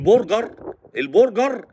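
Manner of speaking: برجر as "bor-gar", round vowel, rolled r; big, emphatic Arabised burger; heavy mispronunciation. Acted. Mocker praising the filling version, heavy mispronunciation. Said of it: "برجر هيشبعك".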